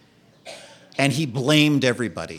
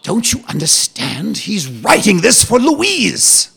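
put-on proper British accent; 7 semitones higher, 4 dB louder than usual. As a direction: confide like a proper British gent. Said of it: "this very proper British man".

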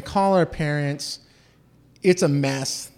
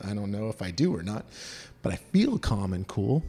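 normal talking voice

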